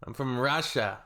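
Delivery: echoing the Yakut girl's punchline in a mock Russian accent. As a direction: say "motherfucker" like "mazafaka" in a Russian accent. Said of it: "She's legendary".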